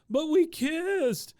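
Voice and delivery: very high, loud, drawn-out wail; mock bawling. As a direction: wail slowly like a heartbroken boy, hammed up. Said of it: "he is just balling at this point".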